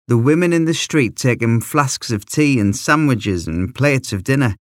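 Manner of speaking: ordinary delivery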